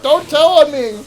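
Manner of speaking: put-on a kid begging not to be told on; mock plea. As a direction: beg her not to tell, loudly. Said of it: "I'm like".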